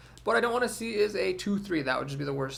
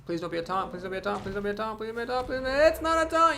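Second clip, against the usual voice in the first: chanting a plea that climbs higher until the relief; building plea; 5 semitones higher, faster than usual. Real, not acted. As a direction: beg the card gods, rising each time. Repeated pleading before reveal.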